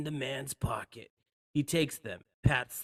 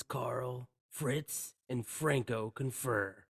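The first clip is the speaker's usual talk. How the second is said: normal talking voice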